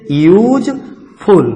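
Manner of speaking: यूजफुल slowly, as यूज, clear gap, फुल; split into syllables. Shown as a demonstration. Teacher giving another two-syllable example, यूजफुल slowly split in two. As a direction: break यूजफुल into two separate pieces. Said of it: "जैसे इसी तरीके से".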